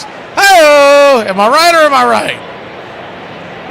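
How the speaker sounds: hammy punchline. Real, not acted. Joke punchline to the chat.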